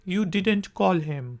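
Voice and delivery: didn't clipped and quick. Shown as a demonstration. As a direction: run did not into didn't. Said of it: "faster form है".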